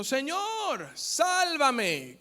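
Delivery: high, loud plea for rescue; desperate cry. Acted. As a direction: cry out like a man sinking. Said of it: "gritó".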